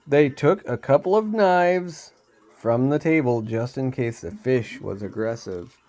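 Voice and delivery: usual speaking voice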